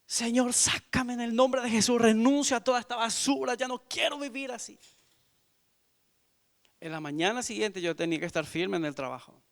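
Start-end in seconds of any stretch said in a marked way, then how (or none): 0.1-4.8 high, pleading, tearful plea; crying prayer. Retold or imitated. Retelling his night prayer to the Lord, high tearful pleading. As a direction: beg to be pulled out, voice up high. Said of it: "llorando ahí".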